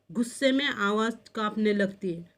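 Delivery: ordinary delivery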